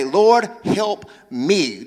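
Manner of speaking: slow pleading prayer; drawn-out plea to the Lord. Shown as a demonstration. Preacher modelling the prayer for the congregation, slow and pleading. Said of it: "Do me a favor, point to yourself, say".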